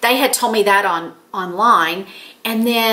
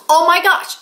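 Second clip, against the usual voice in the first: higher, acted-out burst of excitement; excited exclamation; 8 semitones higher, 4 dB louder than usual. Friend dashing off to fetch the book, mock excited. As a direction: imitate a friend's sudden excitement.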